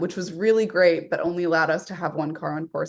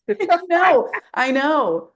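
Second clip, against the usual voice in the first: laughing; 10 semitones higher, 6 dB louder, much slower than usual. Real, not acted. Laughing reply to sketchy.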